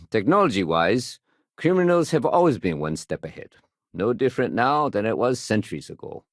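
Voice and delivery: normal talking voice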